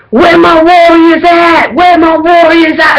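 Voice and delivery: high, drawn-out hype call to the crowd. Retold or imitated. Fan imitating the frontman calling his warriors, rallying cry. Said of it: "he's like".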